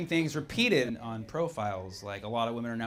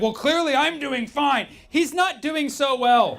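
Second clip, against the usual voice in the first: high, slow, emphatic delivery; hammed-up interpreter call, pitched way up; loud mock interpreting; 12 semitones higher, 6 dB louder, slower than usual. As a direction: translate loudly, dragging out the words. Acting out sarcasm and its translation.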